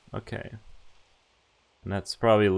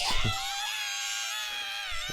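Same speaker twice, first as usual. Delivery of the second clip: real laugh that jumps way up high; shrieking laugh; 7 dB quieter than usual. Real, not acted. Player cracking up at their own yelled line, shrieking laugh. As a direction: burst into a squealing laugh.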